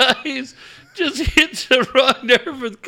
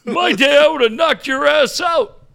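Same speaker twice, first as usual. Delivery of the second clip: high, loud mock-tough old man; old caller squaring up to the coach, high and loud; old caller threatening; 5 semitones higher, 4 dB louder than usual. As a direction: bark a threat like a feisty old fan.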